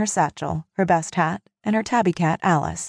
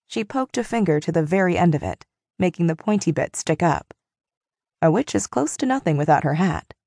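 usual speaking voice

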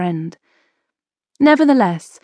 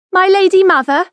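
put-on much higher, louder young boy; a formal young son greeting his mother; boy's voice; 12 semitones higher, 7 dB louder than usual. A narrator's reading. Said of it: "he said".

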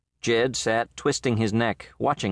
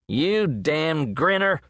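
shouted; 6 semitones higher, slower than usual. A narrator's reading. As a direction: shout the insult at the grinning coyote. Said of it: "Jed shouted".

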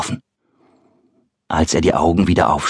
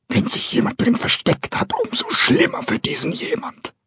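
threatening whisper. Acted. Frightened carpenter threatens hidden someone.

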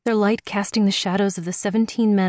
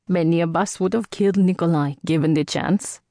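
put-on quick, confident Indian accent; a young man challenging her, in an Indian accent. Rami voiced in story.

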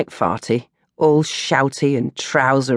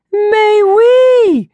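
much higher, loud hammed French; mock French mais oui; 8 dB louder than usual. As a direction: sing it out like a theatrical Frenchwoman.

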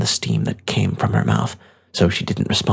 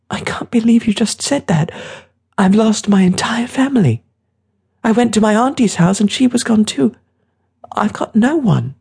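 put-on very high, grief-stricken boy voice; young boy, distraught; 13 semitones higher, 4 dB louder than usual. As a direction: protest through rising grief, high-pitched.

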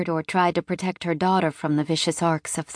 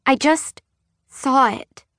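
put-on small scared child; 7 semitones higher, 3 dB louder, slower than usual. A narrator's reading. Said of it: "she said softly".

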